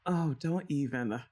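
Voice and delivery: normal talking voice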